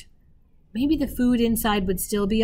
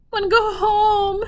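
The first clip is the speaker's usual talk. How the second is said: put-on high, teary little child's whine, much higher and louder; a tired toddler crying to go home; toddler whining; 11 semitones higher, 7 dB louder than usual.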